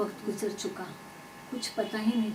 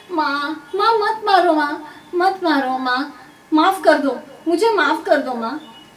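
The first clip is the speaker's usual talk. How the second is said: high, loud cries for mercy; panicked child's plea; desperate pleading; 6 semitones higher, 10 dB louder than usual. A narrator's reading. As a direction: beg mother to stop the beating. Beaten child begs mother to stop.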